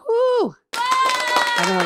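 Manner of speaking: giddy whoop for the new editor; excited whoop. Real, not acted. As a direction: cheer it out, thrilled. Excited about new video editor.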